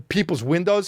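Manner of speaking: ordinary talk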